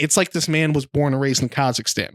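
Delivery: ordinary delivery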